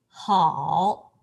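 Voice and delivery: how with a low dipping turn; third tone dipping. Shown as a demonstration. Teacher models a dipping how for students. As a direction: dip low on how then rise. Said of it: "Turn, which means".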